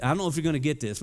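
ordinary delivery

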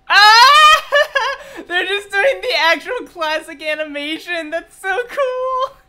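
high loud scream breaking into laughter; real squealing delight. Real, not acted. Fan seeing the classic animation, squealing delight. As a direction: squeal through laughter, voice nearly cracking.

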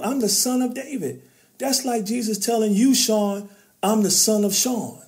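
high, loud preaching cadence about David; building sermon. Real, not acted. To Sean and listening men, rousing preaching voice. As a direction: preach it, lifting the room.

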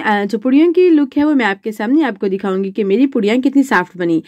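normal talking voice

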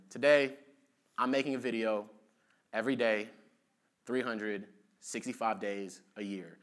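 recreate a voiceover, one phrase per beat; in tempo. Shown as a demonstration. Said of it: "and I had that tempo".

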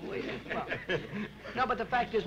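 can't keep a straight face, laughing out the no's. Real, not acted. Caught joking, laughs through denial.

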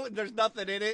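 usual speaking voice

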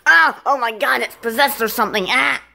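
high yelp, then quick alarmed words; startled panic. Acted. Character thinks the cup is possessed, high panicked outburst.

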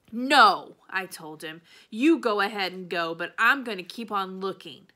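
loud, stubborn refusal to go home; defiant shout. A narrator's reading. Opal refusing her father, a loud defiant cry. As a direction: plant your feet and yell no.

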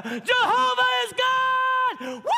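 long, high, loud drawn-out victory cry; triumphant shout. Retold or imitated. Israelites shouting to God on the seventh lap, high drawn-out triumphant shout. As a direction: roar it out like the walls are falling.

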